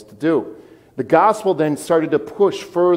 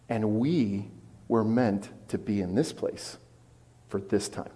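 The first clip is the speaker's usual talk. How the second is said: hushed conviction; 5 semitones lower, 10 dB quieter than usual. Real, not acted. Hushed sermon climax.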